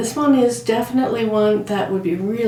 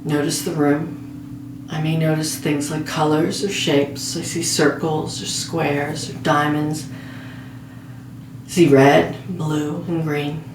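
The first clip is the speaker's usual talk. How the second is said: slow, measured meditation voice with long pauses; guided meditation; slower than usual. Real, not acted. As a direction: guide a listener gently toward sleep, phrase by phrase. Leads a guided sleep meditation.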